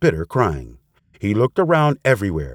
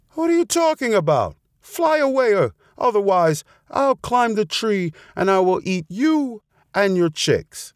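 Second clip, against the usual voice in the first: high-pitched outraged threat; storybook fox's shout without real volume; mock shouting; 11 semitones higher than usual. A narrator's reading. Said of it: "shouted the fox".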